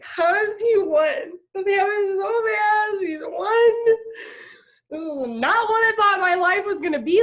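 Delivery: high, loud tearful sobbing; crying. Retold or imitated. Mother crying over her sleeping son, high and tearful. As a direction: let the voice break as you weep.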